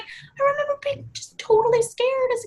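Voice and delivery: put-on high, childlike worried voice; mock scared kid. Recalling being a scared child at bedtime, high and worried. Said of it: "I was like".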